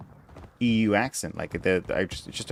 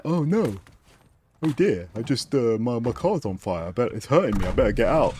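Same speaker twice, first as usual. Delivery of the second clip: mock panicked driver. Gamer mocks the enemy who stayed in his burning car, mock dismay. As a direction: play the fool stuck in a burning car, lightly mocking. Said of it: "Like, he'd be like".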